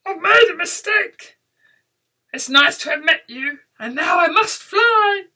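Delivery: high, loud, panicky excuses; spluttering dragon. Acted. Dragon excuses himself to beast, frightened splutter. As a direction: splutter high, desperate to get away. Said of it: "he spluttered".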